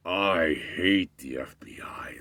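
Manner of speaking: slow emphatic rant. Retold or imitated. Guy repeats it aloud.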